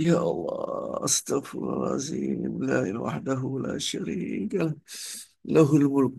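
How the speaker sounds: slow, drawn-out recitation, climbing in pitch; pained dhikr. Shown as a demonstration. Preacher demonstrating prayer while the body hurts, pained dhikr. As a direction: stretch the holy words as if enduring pain. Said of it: "Badan rasanya kayak ditusuk-tusuk".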